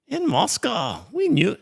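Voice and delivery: a quick, high and hammed-up try at a Russian accent; the Russian neighbour, mocked in a bad Russian accent; attempted Russian accent. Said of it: "no, that sounds more Chinese".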